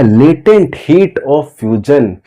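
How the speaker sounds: each word drawn out slowly for the class; slow drilled term. Shown as a demonstration. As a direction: spell it out slowly so students can repeat. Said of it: "यही क्या है?". (शब्दों को धीरे-धीरे खींचकर)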